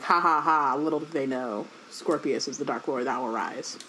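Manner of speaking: low, quiet gloating villain; mustache twirling villain. Retold or imitated. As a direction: gloat it like a cartoon baddie. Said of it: "Draco's in the back twirling his mustache".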